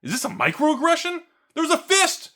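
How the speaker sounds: high, squealing outrage over a punch; whiny mock victim. To the viewers, mocking a button-pusher in a high whine. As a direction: ham up a shocked snowflake. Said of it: "And you go".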